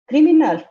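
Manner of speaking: CRIMINAL hit high and loud with outrage; outraged emphasis. Real, not acted. Speaker denouncing the government's pandemic handling, word criminal punched high and loud.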